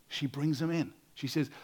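usual speaking voice

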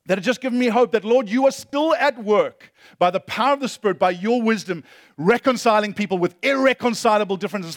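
loud, high preaching that swells; preaching at full force. Acted. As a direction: preach it out, building to a peak.

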